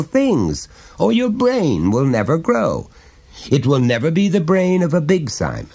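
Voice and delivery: a hint of a chummy dad prying gently; jovial father. Father coaxing his small son to confide, lightly voiced.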